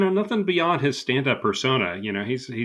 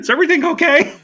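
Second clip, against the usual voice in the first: much higher, louder mock-worried ask; mock concern; 7 dB louder, faster than usual. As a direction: fake worry, high and loud. Mock concerned check-in bit.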